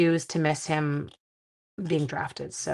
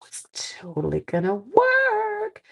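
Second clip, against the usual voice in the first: mock squeaky optimism; 13 semitones higher, slower than usual. Joking about watching while driving.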